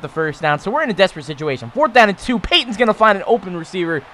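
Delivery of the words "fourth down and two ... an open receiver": urgent play call. Real, not acted. Desperate fourth down call.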